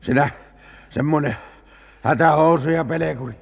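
exasperated, loud telling-off; indignant scolding. Acted. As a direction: hurl it as an insult. Scolds companion after the flight.

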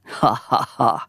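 deliberate, separated ha-ha-ha; acted laugh. A narrator's reading. Eero laughs off his own love confession to Lea, mocking laugh. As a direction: give a staged, mocking laugh. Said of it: "nauroi Eero".

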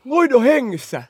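loud high cry of relief; loud joyful yell; excited shout. Retold or imitated. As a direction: shout the news to the room.

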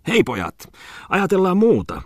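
high, lively character call; rally the lads, pitched well up. Acted. Character calls to his companions.

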